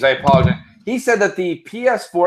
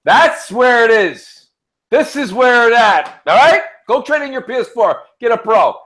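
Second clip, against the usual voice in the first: mock salesman boast; 6 semitones higher, 5 dB louder than usual. Telling his friend to trade in, boastful. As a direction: sell it like a hype man.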